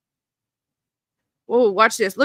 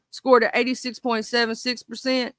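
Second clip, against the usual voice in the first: usual speaking voice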